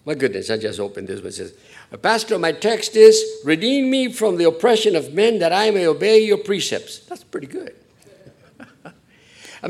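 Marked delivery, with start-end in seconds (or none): 2.0-7.0 much louder and higher, proclaiming; mock congregant reading. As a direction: boom it out like reading a verse aloud.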